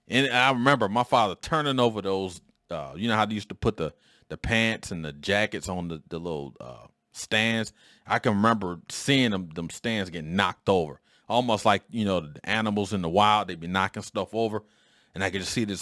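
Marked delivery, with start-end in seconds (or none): none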